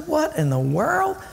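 high-pitched disbelief; mock bewilderment. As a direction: act out a baffled bystander. Acting out bewildered onlooker.

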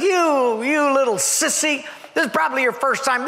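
mock the sissy like a swaggering trucker; tough trucker taunt. Burly trucker taunting the drinker.

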